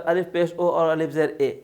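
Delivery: usual speaking voice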